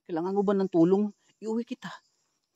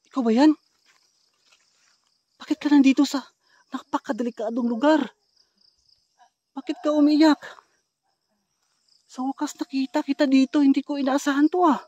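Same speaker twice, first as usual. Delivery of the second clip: calling out; 9 semitones higher, 9 dB louder than usual. Real, not acted. Calling out to the woman.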